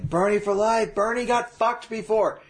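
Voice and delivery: high, excited fan chant; mock Bernie fan. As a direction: cheer like a diehard supporter. Mimicking Bernie fans.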